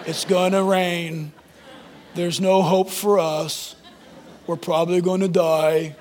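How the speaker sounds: low, slow gloomy donkey voice; Eeyore voice. Retold or imitated. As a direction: droop every word, low and slow. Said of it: "And there's Eeyore".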